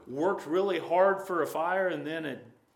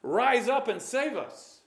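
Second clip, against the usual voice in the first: mocking plea; 7 semitones higher than usual. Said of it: "And he's mocking them".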